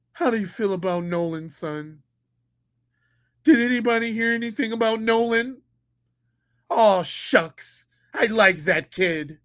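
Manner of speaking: a lightly voiced easygoing father; mock dad voice. Mocking the dad again.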